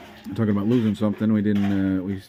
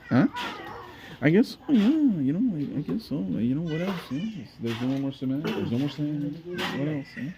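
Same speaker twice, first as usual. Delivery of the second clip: high, light pretend voice for the little one; voicing the baby; 8 semitones higher than usual. Father answers for Benny in a high pretend child voice. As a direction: answer for the kid in a higher, playful voice. What do you think, Benny?.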